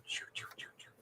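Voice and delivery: whispered, voiceless whooshing sounds; people whizzing by. Retold or imitated. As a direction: imitate a crowd zipping past. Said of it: "just going so fast around".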